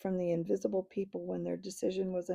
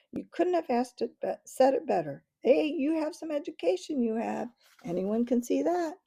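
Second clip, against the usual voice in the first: flattering chorus; 7 semitones higher than usual. A narrator's reading. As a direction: flatter him, impressed.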